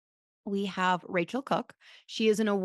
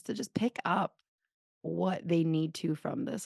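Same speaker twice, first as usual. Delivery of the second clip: prayer; 2 semitones lower than usual. Acted. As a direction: pray it gently, slowing down.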